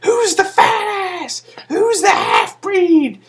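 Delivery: high, slow, hammed-up yelling taunt, laughing; squeaky mock heckle from ringside; mock Samoa Joe shout. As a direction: bellow the insults in a cartoon voice. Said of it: "and he's like".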